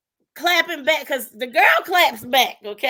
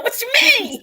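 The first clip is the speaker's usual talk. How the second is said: mock indignant; 10 semitones higher, 5 dB louder, faster than usual. Mock offended reply.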